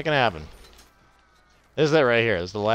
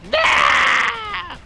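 high, loud burst of a yell; sudden shout; 10 dB louder than usual. Real, not acted. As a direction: blurt it out in a yell.

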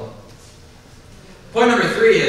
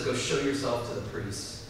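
quiet Jesus; 5 semitones lower, 9 dB quieter than usual. Retold or imitated. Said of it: "And Jesus says".

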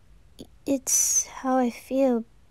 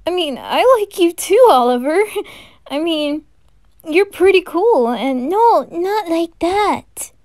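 flustered reply; 5 semitones higher, 7 dB louder than usual. Acted.

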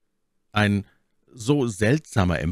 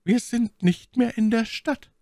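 hoch und lauter, beunruhigt; beunruhigte Mylady. A narrator's reading. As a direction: als erschrockene Lady, höher. Voiced Milady. (Mylady spricht den schweigenden Offizier ängstlich an)

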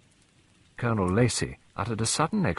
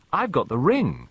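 put-on higher, girlish announcement; bright young girl's clear call; 6 semitones higher, 3 dB louder than usual. A narrator's reading. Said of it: "in a clear, high voice".

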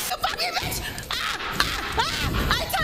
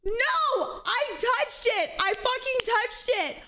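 high, incredulous cries of outrage; outraged shouting; 7 semitones higher, 4 dB quieter, slower than usual. Real, not acted. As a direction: scream in disbelief at the game. Streamer outraged by hitbox.

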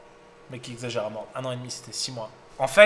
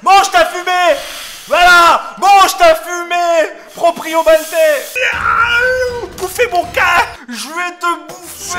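very high, very loud triumphant yell; gloating shout; 11 dB louder, slower than usual. Real, not acted. Streamer taunting the loser after winning, triumphant shout. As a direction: scream it at the beaten opponent.

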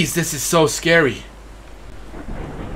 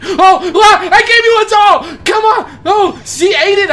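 very high, loud protest in panic; panicked shriek; 7 dB louder, slower than usual. Real, not acted. Player pleading with creepy girl after giving a doll, panicked shriek. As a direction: shriek as the offering fails. Ghost attacks despite the doll.

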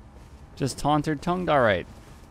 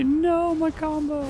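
mock dismay; slower than usual. Real, not acted. Loses his combo.

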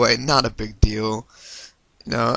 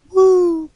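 high, louder "woo!"; excited whoop; 4 dB louder than usual. Real, not acted. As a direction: let out a high-pitched cheer. Cheering himself after a success.

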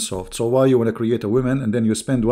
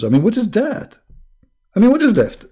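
much higher, disbelieving outcry; incredulous; 11 semitones higher, 5 dB louder than usual. Real, not acted. Mocking the scripture, a high incredulous outburst. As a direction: throw the question up in disbelief.

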